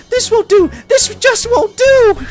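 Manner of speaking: very high, loud squealed dismay; frantic high cartoon wail, last word stretched; Pinkie Pie. Pinkie lacking party supplies, frantic.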